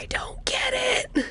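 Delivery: high, slow, breathless whisper; tearful whisper. Acted. Lost figure whispers in confusion over the gift. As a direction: whisper it close to tears.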